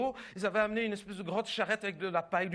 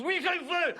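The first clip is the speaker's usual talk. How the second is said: higher, bursting yes; eager yes; 7 semitones higher than usual. Acted. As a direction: blurt it before the question ends. Eager groom cuts in.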